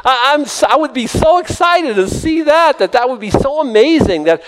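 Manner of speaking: high-pitched, eager excitement; excited. Real, not acted. Preacher imagining a withered hand restored, excited and high. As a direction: pitch up, thrilled at the very idea.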